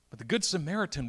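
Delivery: ordinary talk, nothing marked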